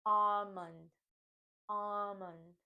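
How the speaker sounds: almond as AH-mund, no L; mund second syllable. Shown as a demonstration. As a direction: model almond with its ending like month. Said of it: "we want to think of it as mund".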